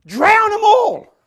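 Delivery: high, loud, drawn-out yell; triumphant shout. Real, not acted. Preacher saying what God does to pursuers, triumphant shout. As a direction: bellow it slowly.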